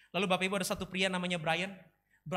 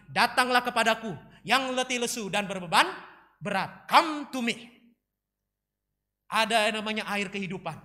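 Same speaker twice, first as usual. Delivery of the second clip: loud, emphatic, stretched phrases; fervent preaching; 3 semitones higher, 7 dB louder than usual. Real, not acted. Preacher calls the church to Jesus, fervent and loud. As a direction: proclaim it to the congregation with force.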